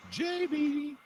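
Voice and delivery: soft, very high falsetto call; high, quiet calling to someone missing; coaxing call. Real, not acted. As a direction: coo the name way up high. Coaxing a silent caller to answer.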